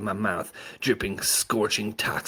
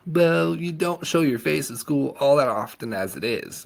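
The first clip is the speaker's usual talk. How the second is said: chimed in helpfully; 7 semitones higher, 4 dB louder than usual. A narrator's reading. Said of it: "chimed in helpfully".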